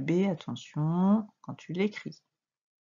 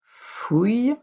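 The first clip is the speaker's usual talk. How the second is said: higher, loud syllable with a rising end; dictation; 6 semitones higher, 6 dB louder than usual. Shown as a demonstration. Teacher repeating a syllable for dictation, loud and rising. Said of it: "Quatrième syllabe".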